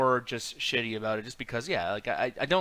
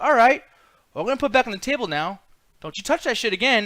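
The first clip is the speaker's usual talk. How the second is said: high, loud, fast scolding; mock stern warning; 7 semitones higher, 5 dB louder than usual. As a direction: wag a finger at the thief.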